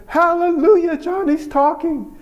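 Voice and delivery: high, loud joyful shout; overjoyed cry. Retold or imitated. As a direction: shout it out with joy like a proud mother. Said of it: "she jumped up out of her seat".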